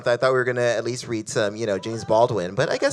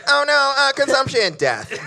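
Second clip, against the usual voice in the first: British whine; 10 semitones higher than usual. Retold or imitated. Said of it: "That's every Dickens story to me".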